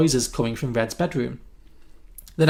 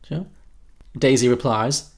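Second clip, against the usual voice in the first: ordinary talk, nothing marked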